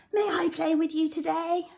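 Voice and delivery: high, loud call out the window; shouting out the window. Shown as a demonstration. Teacher to pupils, shouting the sentence out the window. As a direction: shout the sentence for all to hear. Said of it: "Shout it out your window".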